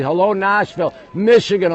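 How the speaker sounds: loud, high call of MICHIGAN; yelled shout-out. Real, not acted. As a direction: yell MICHIGAN out to a viewer. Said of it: "I'm not gonna yell that".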